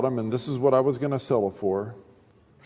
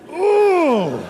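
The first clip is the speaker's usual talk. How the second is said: frustrated groan; 12 semitones higher, 6 dB louder than usual. Real, not acted.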